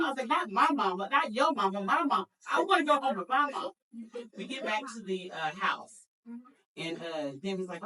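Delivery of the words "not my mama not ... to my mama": put-on loud, high childish wail for mama; mock wail. Scared teen begging her sister, in a loud childish wail. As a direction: whine it like a scared kid.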